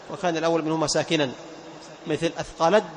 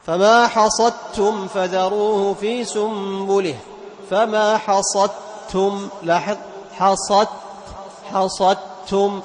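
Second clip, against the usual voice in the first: slow, louder tajweed chant; Quran recitation; 3 semitones higher, 4 dB louder, much slower than usual. Shown as a demonstration. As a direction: draw out each word as in tilawa. Recites Quran verse as example. (تلاوة مجودة متمهلة)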